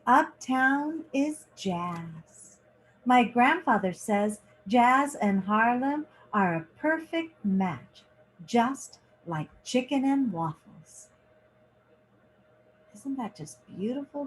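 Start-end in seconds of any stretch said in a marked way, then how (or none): 0.1-11.1 lively picture-book reading, lingering on each page; reading to children. A narrator's reading. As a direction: read it like story time for kids. Reads picture book aloud.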